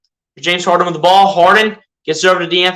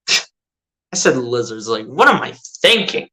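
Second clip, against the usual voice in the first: self-mocking; 8 semitones lower, 4 dB quieter than usual. Real, not acted. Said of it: "let me re-, say that".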